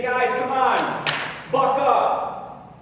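jolly the mourners along, tactlessly; hammed-up insensitive rallying cry; mock pep talk. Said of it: "does he say".

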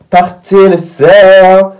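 mock command. Commanding dark circles to leave.

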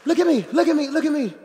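loud, high repeated demand; preacher commanding look at me; insistent call. Real, not acted. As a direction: grab the whole room.

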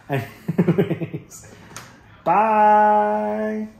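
high, loud, sing-song bye; excited goodbye. Real, not acted. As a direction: call out a bright farewell. Cheerful sign-off to viewers.

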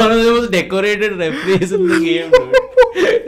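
high, loud, laughing exclamation; real laughter pushing the voice way up; cracking up. Real, not acted. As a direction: shout it through helpless laughter. Hilarity over the referee spanking story.

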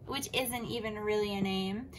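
plain reading voice

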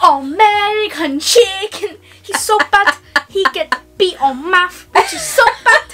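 comic father in a put-on accent, full of scorn; Stephen He. Partner mocking his imaginary son, hammed-up dad accent. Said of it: "he does this amazing accent".

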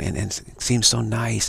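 normal talking voice

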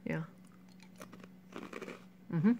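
muffled chewy speech; talking while chewing. Real, not acted. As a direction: mouth full of snack. Seen 0: Puts snack in mouth at 0, chews through the words.

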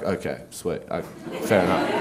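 low, soft easy acceptance; just shrug it off quietly; shrugging kid. Pretend. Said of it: "he would have been like".

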